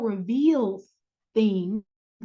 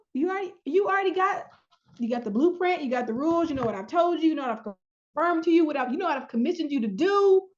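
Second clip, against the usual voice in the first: higher, brisk, no-nonsense; a parent done repeating themselves; exasperated God; 4 semitones higher than usual. Pretend. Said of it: "Now he's like".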